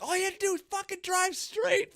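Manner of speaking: very high mock scream into the mic; laughing scream. Screaming at his driving friend, very high. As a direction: shriek it at your friend. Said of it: "screaming in the mic".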